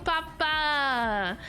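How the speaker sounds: ordinary talk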